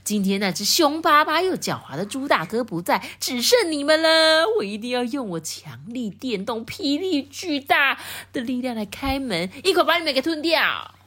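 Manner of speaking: a hint of a sneering wolf; cold sneer. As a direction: gloat over the trapped piglets. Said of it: "就发出冷笑".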